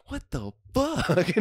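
high, slow, drawn-out mock astonishment; stunned disbelief. Manager at lunch reacting to the customer, stunned. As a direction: stretch it out, utterly baffled.

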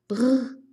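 trilled "brr" of feeling cold; shivering brr. Shown as a demonstration. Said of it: "颤抖的时候用这个语气词哈".

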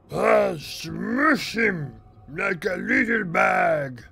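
slow, high bellowed threat; mock threat shout. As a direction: roar it out like a brawler. Threatening to beat up teammate.

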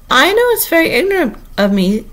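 high, meek admission; humble tone. A narrator's reading. Alice apologising to Humpty Dumpty, humbly. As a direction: own up in a small high voice. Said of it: "said in a humble tone".

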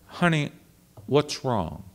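softly voiced elderly coach asking; Helen Hodem. Coach questioning her singer before the orchestra, soft imitation. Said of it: "She said".

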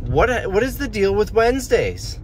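high-pitched mock Seinfeld bit; lightly voiced Seinfeld observational whine; Jerry Seinfeld. Shown as a demonstration. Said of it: "That's my Jerry Seinfeld impression".

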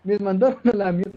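high, loud swearing outburst; loud startled cursing with a laugh; real shout. Real, not acted. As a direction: yell it out, stretching the curse. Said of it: "perdón, si grité muy fuerte".

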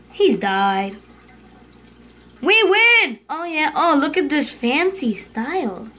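ordinary delivery

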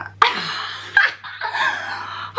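high, breathy laughter after a prank; bursting laugh. Real, not acted. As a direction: let the held-in laughter out. Laughs once call has ended.

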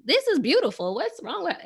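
mock incredulous squeak; inner disbelief. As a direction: react in your head, stunned. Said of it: "in my head, I was like".

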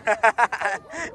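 hearty run of laughs; laughter. Real, not acted. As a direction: laugh at a mistaken question. Laughs at being asked about goal. (risa a carcajadas)

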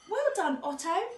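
high, loud, hearty well-done; congratulating. A narrator's reading. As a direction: cheer on a child proudly. Dad congratulates Otto.

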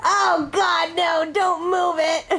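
ordinary talk, nothing marked

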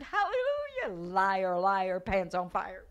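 high hallelujah falling into a jeer; triumphant taunt. Retold or imitated. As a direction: crow it at the beaten devil. Celebrates, taunts the devil.

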